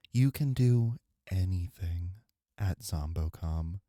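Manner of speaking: slow, drawn-out parody ASMR read of the Zombocom script; mock Zombocom ASMR. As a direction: savour each word like a lazy ASMR host. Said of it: "can we do Zombocom A S M R?".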